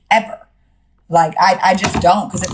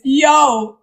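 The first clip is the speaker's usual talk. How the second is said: usual speaking voice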